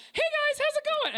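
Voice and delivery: high, squeaky cartoon mouse greeting; Mickey Mouse voice. A cartoon mouse greeting a passing family, high and chirpy. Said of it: "Mickey Mouse walks by and is like".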